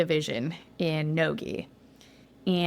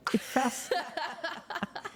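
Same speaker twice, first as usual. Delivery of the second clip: imitated delighted cheering laugh; 9 semitones higher, 8 dB quieter than usual. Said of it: "they're both like".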